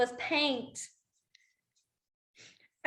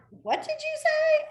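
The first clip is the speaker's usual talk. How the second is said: confused; slower than usual. Retold or imitated. Confused asking kid to repeat.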